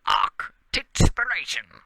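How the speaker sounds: slow, loud mock Scottish brogue; Scottish accent. Still joking about the crush, in a mock Scottish accent. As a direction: lean into a joke Scots burr. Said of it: "the accent".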